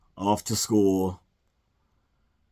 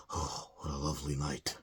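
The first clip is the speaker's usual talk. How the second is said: put-on soft old Geppetto; 6 semitones lower, 14 dB quieter than usual. Geppetto at the window.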